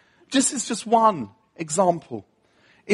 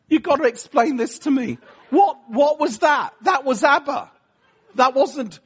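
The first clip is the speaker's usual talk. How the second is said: tag; 7 semitones higher than usual. Retold or imitated. Retold baffled demand for an explanation.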